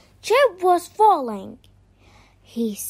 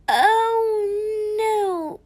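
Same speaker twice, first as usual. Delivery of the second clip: higher-pitched, drawn-out dismay; dismayed gasp; 6 semitones higher than usual. A narrator's reading. Chip spotting trouble from above, gasped dismay. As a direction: gasp it in storybook alarm. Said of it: "gasped Chip".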